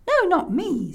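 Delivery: put-on high-pitched child voice; brave little boy. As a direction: protest eagerly like a small boy.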